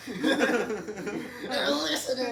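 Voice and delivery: laughing mock alarm. Son joins the paranoid bit.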